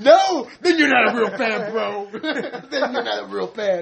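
high, loud mock bro through laughter; laughing mock fan. Mocking gatekeeping metal fans while laughing, high and loud. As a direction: giggle through a snooty fan voice.

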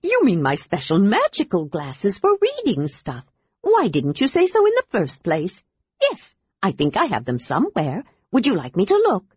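bubbly little adventurer who can't stop talking; excited kender. Acted. Kender Tass delighted.

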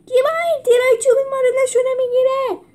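high loud fast shriek; fast panicked shriek; shrieked question. A narrator's reading. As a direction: scream it as arrows fly. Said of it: "جولیوس جیغ زد".

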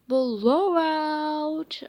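high, loud announcer yell; excited bowling alley yell. A narrator's reading. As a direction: blast the call out. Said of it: "the guy yelled".

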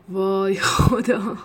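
loud, drawn-out gasp of excitement; thrilled disbelief. A narrator's reading. Getaway driver laughing from excitement, thrilled disbelief. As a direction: stretch it out slowly and loudly, exhilarated. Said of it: "از هیجان خندیدم".

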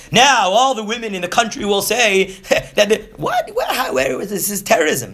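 high, laughing, outraged mock minister; mock Memuchan. Mocking Memuchan alarmed about the women. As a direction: ham up the panicked advisor. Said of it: "as Memuchan says".

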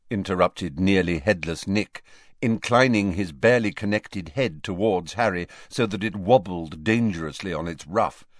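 ordinary delivery

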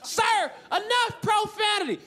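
put-on high, drawn-out Southern-accented reprimand; scolding Southern nurse. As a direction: scold sharply in a mock Southern country voice. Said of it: "thick Southern country accent".

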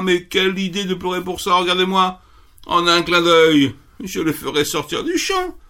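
pitched high, brisk and bouncy; a perky little rabbit scoffing kindly; high cheerful rabbit. Acted. Rabbit cheering up the crying fox, high and brisk.

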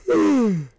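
a long yawn sliding up high; real yawn. Real, not acted. Sleepless dad on an early-morning podcast, yawning loudly. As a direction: let a loud, wide yawn out. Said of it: "I'm sorry for the yawning".

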